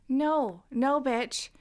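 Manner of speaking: ordinary talk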